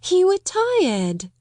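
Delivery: slow, high, kind reassurance; friendly and kind. A narrator's reading. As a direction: tell her gently it's all right. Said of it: "friendly little laugh".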